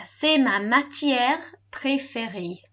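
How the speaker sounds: slow, measured "C'est ma matière préférée"; all together. Shown as a demonstration. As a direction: model the whole of C'est ma matière préférée slowly. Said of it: "All together".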